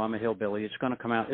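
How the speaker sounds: ordinary talk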